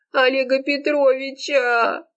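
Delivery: long, high howl of heartbreak; lovesick wail. A narrator's reading. Serafima reveals whom she loves, wailing. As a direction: draw the name out in a high wail. Sobbing Serafima names her beloved.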